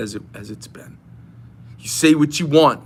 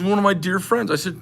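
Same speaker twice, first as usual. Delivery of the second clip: usual speaking voice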